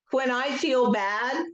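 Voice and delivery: slow guided script with long pauses; guided tapping script. Acted. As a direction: lead the group gently, line by line.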